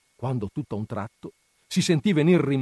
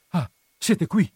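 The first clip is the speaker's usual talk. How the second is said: slow, raised, effortful question; words forced out; 7 semitones higher, 5 dB louder, slower than usual. A narrator's reading. Agnese arriving breathless to the couple, words forced out. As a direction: push the words out as if winded. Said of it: "cavando fuori la parola a stento".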